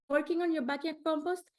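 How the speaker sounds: ordinary delivery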